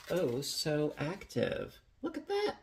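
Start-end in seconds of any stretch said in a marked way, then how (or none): 2.0-2.6 excited. Real, not acted. Admiring risen dough.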